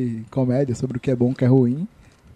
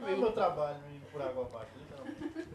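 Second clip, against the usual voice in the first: far from mic; 22 dB quieter, faster than usual. Real, not acted.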